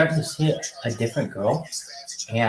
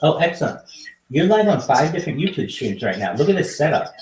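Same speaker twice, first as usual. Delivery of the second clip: hyped host greeting; 5 semitones higher than usual. Acted. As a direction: greet strangers like a pumped-up show host. Hyped greeting to video-chat strangers.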